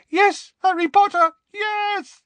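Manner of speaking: very high, loud elf wail; squeaky house elf. Acted. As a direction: cry it out as a terrified elf. Dobby howling in terror.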